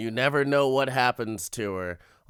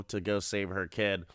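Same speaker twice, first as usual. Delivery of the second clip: ordinary delivery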